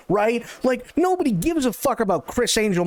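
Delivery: normal talking voice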